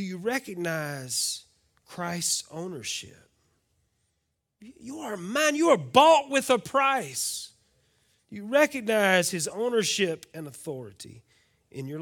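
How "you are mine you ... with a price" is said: high, ringing declaration; fervent proclamation. Acted. Pastor voicing God's claim on believers, ringing out. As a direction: proclaim ownership with fire.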